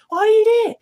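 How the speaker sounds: shrill mock panic, pushed harder. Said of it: "Great levels of tension".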